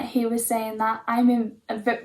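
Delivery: ordinary talk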